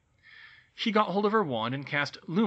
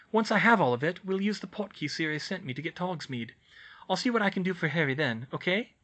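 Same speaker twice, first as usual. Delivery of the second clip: put-on higher-pitched, louder girl's voice; Hermione, brisk and organised; 5 semitones higher, 4 dB louder than usual. A narrator's reading. Student calms a panicking elf, brisk higher-pitched instructions. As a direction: take charge and lay out the plan.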